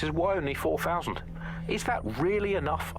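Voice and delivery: usual speaking voice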